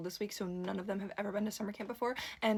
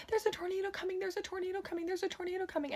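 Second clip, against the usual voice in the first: imitated screaming; 11 semitones higher than usual. Camper sprinting across the field warns of tornado, imitated screaming. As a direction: repeat it in a high, urgent mock scream.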